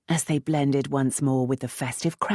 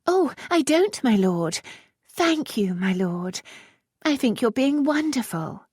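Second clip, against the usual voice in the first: very high, gushing silly voice; fluttering high flattery to disarm him; silly flighty gushing; 11 semitones higher than usual. Acted. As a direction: gush like an empty-headed flirt. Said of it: "The sillier she sounded".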